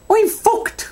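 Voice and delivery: put-on high, slurred Irish drunk voice; drunk Irish woman. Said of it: "You sounded a bit like Sarah Millican".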